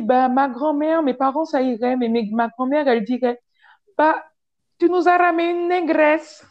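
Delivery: put-on Italian accent. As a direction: mimic the guy in a mild Italian accent. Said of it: "il me fait donc l'accent italien". (léger accent italien imité)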